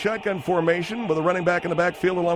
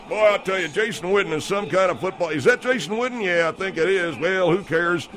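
normal talking voice

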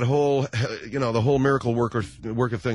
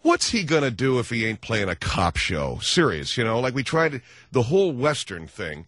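ordinary talk, nothing marked

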